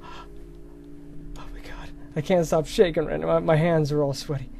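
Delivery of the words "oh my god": hushed and tense; scared whisper. Real, not acted. Frightened gamer in a horror game, whispering. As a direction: breathe it out in fear.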